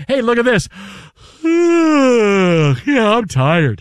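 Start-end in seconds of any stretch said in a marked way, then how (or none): 0.1-2.8 mock big yawn. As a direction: stretch into a lazy yawn. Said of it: "just went".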